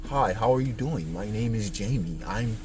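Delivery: a hint of a proper public voice. Introducing himself to strangers in public, lightly put-on proper voice.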